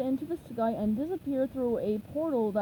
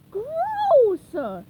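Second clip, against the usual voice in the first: very high, much louder gross; disgusted cry; 13 semitones higher, 10 dB louder than usual. A narrator's reading. Little boy finds Patrick in his ice cream, loud disgusted cry. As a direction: fling the word out in disgust. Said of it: "he cried".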